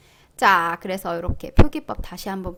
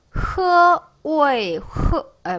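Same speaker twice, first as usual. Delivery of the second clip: ordinary delivery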